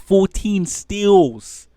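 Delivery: high, loud and slow shocked question; shocked disbelief. Real, not acted. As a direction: gasp it out in disbelief. Shocked at player's stat line.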